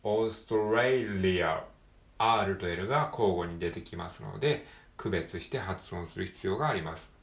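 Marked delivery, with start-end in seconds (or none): 0.0-1.6 "オーストレイリア" with an R in レイ and an L in リ; R and L in Australia. Shown as a demonstration. Teacher contrasting R and L in Australia. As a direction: give レイ an R and リ an L. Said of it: "リの部分でL".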